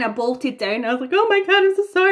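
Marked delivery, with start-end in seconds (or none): 1.1-2.1 higher, louder mock-distraught apology; imitating tearful apology. To the cast backstage after a missed cue, mock tearful and high. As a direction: replay the panicked sorry to the cast. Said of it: "And I was crying".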